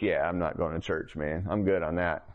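low, unimpressed brush-off; dismissive refusal. Retold or imitated. Sick newcomer refusing the house's church invitation, low dismissive refusal. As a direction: turn them down in a low, casual voice.

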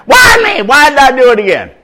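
high, loud anguished shout; despairing shout. Retold or imitated. Inmate blaming himself in jail, a loud anguished shout. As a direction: howl the regret out fast. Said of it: "My brother Richard said that".

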